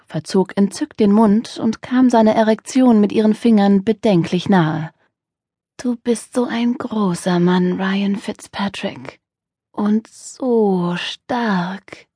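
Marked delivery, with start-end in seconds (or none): 5.8-12.1 soft, slow coo, quieter. A narrator's reading. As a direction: coo it to flatter him. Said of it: "gurrte sie". (gurrend, leise und langsam)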